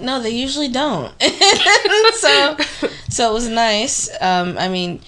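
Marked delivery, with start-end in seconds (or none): 1.2-2.6 loud high laugh. Real, not acted. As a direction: burst out laughing. Laughing at work jokes.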